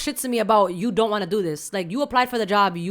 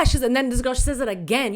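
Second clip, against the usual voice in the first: ordinary talk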